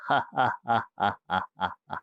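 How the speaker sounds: gloating ghost laugh; evil cackle. Acted. Ghost laughs at screaming coolie. (चीखते कुली पर हँसता भूत, धीमी शैतानी हँसी)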